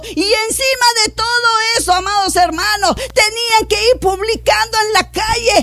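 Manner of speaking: high, urgent pulpit cry; impassioned preaching. Acted. Preaches at full force.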